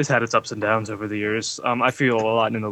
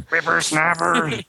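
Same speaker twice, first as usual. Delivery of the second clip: put-on old prospector; 5 semitones higher, slower than usual. Grumpy old man bit.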